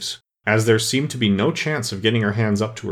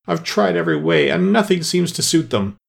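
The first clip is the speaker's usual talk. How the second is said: high-pitched, exasperated lament; kind of sob; 7 semitones higher than usual. A narrator's reading. Pigeon complains that nothing suits the serpents, half sobbing. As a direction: wail it with a touch of a sob. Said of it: "added with a kind of sob".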